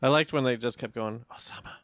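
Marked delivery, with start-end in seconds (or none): 1.3-1.7 whispered call of a name; hushed call. Retold or imitated. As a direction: breathe the name out low. Soldiers calling out in movie.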